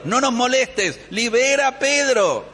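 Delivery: high-pitched mock impatience, then back to praying; annoyed brush-off. Praying people interrupted by Rode, impatient. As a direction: shoo the interruption away and keep pleading.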